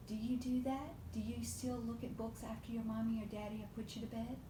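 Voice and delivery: usual speaking voice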